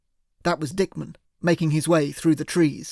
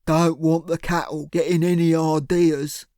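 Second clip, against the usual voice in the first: thug voice. A narrator's reading. Cyril's thug voice.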